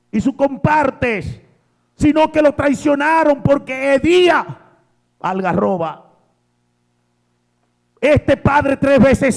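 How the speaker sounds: high, loud, fervent preacher's cry; preaching with fire, slowing on holy and pure; full-force preaching. Acted. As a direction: hammer each phrase to the congregation. Preaching the embrace.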